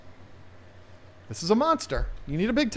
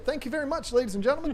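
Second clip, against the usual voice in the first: mock showman; 10 semitones higher, faster than usual.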